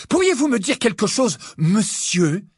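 high, loud defiant interruption; angry retort. A narrator's reading. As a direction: cut in, bristling with anger. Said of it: "en se mettant à nouveau en colère".

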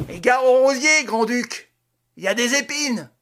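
high, shouted mocking farewell; loud taunt. A narrator's reading. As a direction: Lupin jeers after the man he threw out. Lupin taunting thrown rival. (lance une raillerie criée)

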